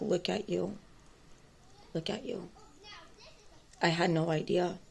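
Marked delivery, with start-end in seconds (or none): 0.0-2.5 softer, almost under the breath; hushed. Retold or imitated. Clerk reacting to the story, much softer. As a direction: murmur it like a stunned clerk.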